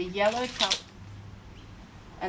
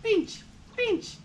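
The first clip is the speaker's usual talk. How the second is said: high singsong; 10 semitones higher, slower than usual. Acted. Sound play with toddler.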